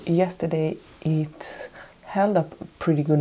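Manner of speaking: breathy whisper. Real, not acted. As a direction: whisper it softly. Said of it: "with a very low voice".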